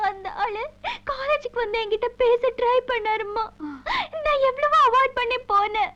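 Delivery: high, tearful words through sobs; crying daughter confiding to her mother. Acted. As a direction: tell mother through tears, voice high and wet. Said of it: "ஏம்மா அழற?".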